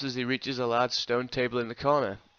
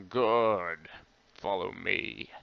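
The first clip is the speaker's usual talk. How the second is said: low wizard voice; 4 semitones lower, much slower than usual. Acted.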